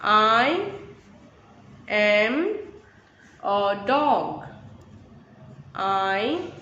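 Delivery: each word alone with long pauses. Shown as a demonstration. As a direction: spell the sentence out one word at a time, slowly. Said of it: "so what will come?".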